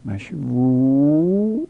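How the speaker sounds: مشوو said very slowly with the final و held long; slow lengthened مشوو; drawn-out vowel. Shown as a demonstration. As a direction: stretch the و of مشوو to point at it. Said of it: "واو لام الفیلی".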